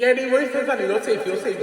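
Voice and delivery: high-pitched mock reassurance with a laugh; imitated reassuring dentist. Dentist telling the patient she is safe, imitated reassurance.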